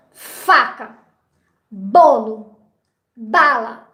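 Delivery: "faca", "bolo", "bala", each with a strong first syllable; stress on first syllable. Shown as a demonstration. Teacher showing examples of tonic syllables, first syllable stressed. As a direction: punch the opening syllable of "faca", "bolo", "bala", pausing between words. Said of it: "a primeira sílaba é a sílaba mais forte".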